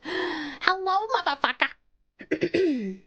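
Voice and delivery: drawn-out, playful British greeting; British accent. Shown as a demonstration. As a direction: show off a British accent, stretching the end. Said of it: "I'm okay at a British accent".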